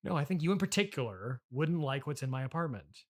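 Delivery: ordinary delivery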